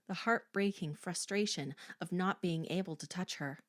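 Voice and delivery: ordinary delivery, nothing marked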